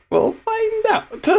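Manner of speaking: a put-on squeak of mock dread; squeaky high voice. Teasing viewers about the next upload, in a squeaky high voice.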